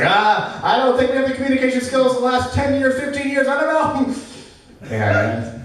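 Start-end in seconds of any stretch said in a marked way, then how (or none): 0.0-4.1 much higher and fast, squeaky flustered excuse; mock flustered excuse. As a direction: rattle it off high and fast like a panicked partner. Imitating a fumbled excuse in the cab.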